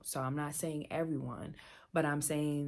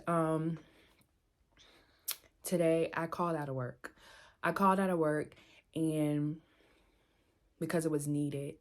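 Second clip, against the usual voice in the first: usual speaking voice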